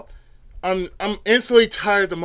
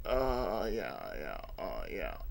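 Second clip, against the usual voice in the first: far lower, much softer groaned yeahs; reluctant; 12 semitones lower, 14 dB quieter, slower than usual. Retold or imitated. Tired teacher grudgingly answering coworkers, low groans. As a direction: barely drag it out, half-asleep.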